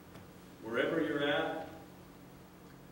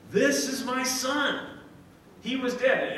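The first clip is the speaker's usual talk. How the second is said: higher, louder, slow joyful announcement; proud father proclaiming; 9 semitones higher, 7 dB louder, slower than usual. Retold or imitated. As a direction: announce it to the whole village. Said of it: "he's saying".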